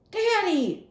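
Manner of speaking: loud high call for Daddy; shout. Acted. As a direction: call him back through the door. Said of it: "I shouted".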